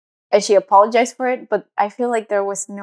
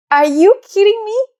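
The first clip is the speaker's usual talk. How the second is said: much higher and louder, disbelieving; incredulous; 11 semitones higher, 5 dB louder than usual. Retold or imitated. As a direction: fire it back in shocked disbelief. Indignant disbelief at her mother.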